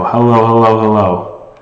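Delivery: vintage echo chamber, boomy room. Real, not acted. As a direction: speak into an echoey room. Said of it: "Too much echo".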